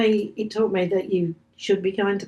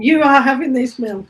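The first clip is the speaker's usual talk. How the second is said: insistent; 4 semitones higher, 6 dB louder than usual. Retold or imitated. Insisting the woman takes milk.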